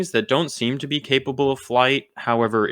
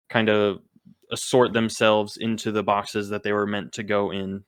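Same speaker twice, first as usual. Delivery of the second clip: ordinary talk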